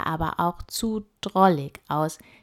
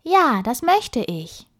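put-on young girl voice; 6 semitones higher, 5 dB louder than usual. Emma answers the voice.

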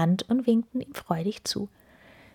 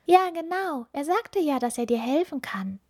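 put-on high child voice, gently comforting; 8 semitones higher than usual. A narrator's reading. Little witch Ilvi comforting the weeping ice fairy, high and gentle. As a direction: reassure the fairy like a little girl. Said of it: "tröstete Ilvi die Eisfee".

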